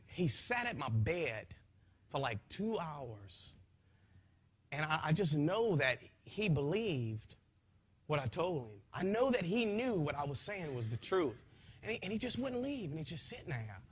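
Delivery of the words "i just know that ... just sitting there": usual speaking voice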